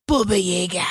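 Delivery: dramatic put-on voice for the name; movie-style Baba Yaga. Shown as a demonstration. Showing co-host how the movie says it, dramatic put-on voice. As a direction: intone it like a feared legend. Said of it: "And that's how they say it".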